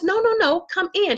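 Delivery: mock eager neighbor inviting; neighbor's invitation. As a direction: warmly insist they come in. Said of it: "She says".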